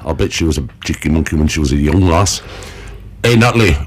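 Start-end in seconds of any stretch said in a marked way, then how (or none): 0.1-2.4 quick, softer northern English puppet voice; northern English accent. Acted. Puppet monkey gossiping about the host, northern English accent. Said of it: "northern accent".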